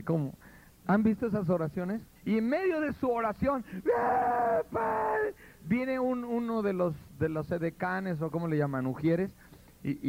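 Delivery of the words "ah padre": mock high crying shout of "padre"; theatrical crying prayer. Pastor opening a worship congress, hammed-up wailing shout. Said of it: "¿Por qué le hablan así al Señor?".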